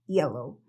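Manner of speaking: yellow starting on a plain Spanish i, said low. Shown as a demonstration. Teacher shows how she says yellow. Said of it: "Yo digo una I".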